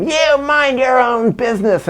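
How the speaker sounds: high, changed voice telling him off; mock yelling. Women on the subway yelling, high mock scolding. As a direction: snap it back high like a stranger. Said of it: "started yelling".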